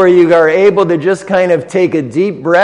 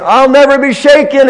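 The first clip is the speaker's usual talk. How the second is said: higher and louder, "never" lifted with defiant confidence; psalmist's bold vow; 9 semitones higher, 4 dB louder, faster than usual. Retold or imitated. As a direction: voice the psalmist proudly declaring he can't be shaken. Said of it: "he's almost saying".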